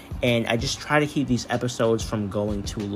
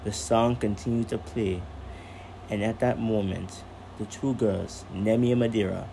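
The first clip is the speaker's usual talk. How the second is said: calm storyteller reading aloud; story narration; 5 dB quieter than usual. A narrator's reading. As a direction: read it like a bedtime story.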